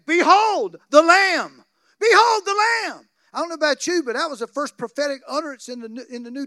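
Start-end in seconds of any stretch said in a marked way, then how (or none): 0.1-3.1 full-force higher, louder shout; shouted proclamation. Acted. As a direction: thunder it out to the crowd.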